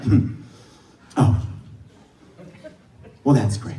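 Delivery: low, soft deadpan with long pauses; understated, withering politeness; unimpressed response. Retold or imitated. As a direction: imitate a listener killing the excitement. Girl's imagined negative reply.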